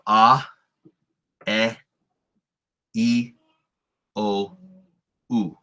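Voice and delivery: oral vowels ah, eh, e, o, u held pure. Shown as a demonstration. Teacher showing learners the oral vowels, clean oral sounds. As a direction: say ah, eh, e, o, u clearly, each alone. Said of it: "These are oral vowels".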